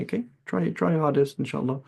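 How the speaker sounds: gentle reassurance. Retold or imitated. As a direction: coax a struggling student kindly. Gentle teacher reassuring student.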